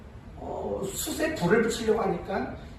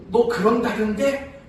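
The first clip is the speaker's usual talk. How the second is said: stern accusing question; 5 semitones higher, 5 dB louder than usual. Retold or imitated. Elders interrogating pastor's son.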